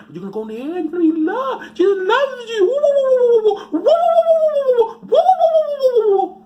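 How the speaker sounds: shrieking high, loud woos; mock ecstatic preacher. Comic as fake preacher whooping to followers, shrill.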